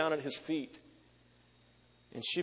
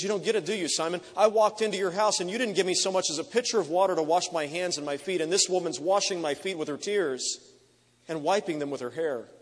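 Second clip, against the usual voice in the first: raised, louder voice of a rebuking Jesus; rebuke; 5 semitones higher, 5 dB louder than usual. Acted. Jesus confronting Simon the Pharisee at dinner, raised rebuke. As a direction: scold the host with rising force.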